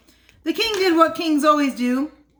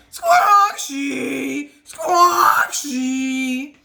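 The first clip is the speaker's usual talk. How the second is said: 大きないびき; much slower than usual. Acted. Princess snoring at night.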